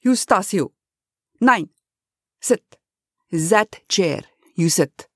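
put-on firm German-accented order; commanding. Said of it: "Wilrich commanded".

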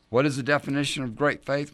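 ordinary talk

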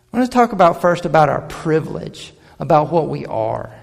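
ordinary talk, nothing marked

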